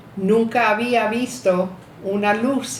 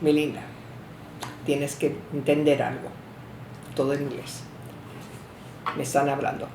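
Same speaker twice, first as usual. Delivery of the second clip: gentle grandparents; 4 semitones lower, 9 dB quieter than usual. Retold or imitated. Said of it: "se sentaron conmigo".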